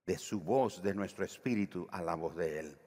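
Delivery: normal talking voice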